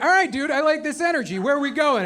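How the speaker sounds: mock excitement; game enthusiasm. Acted eager passenger humoring Waze.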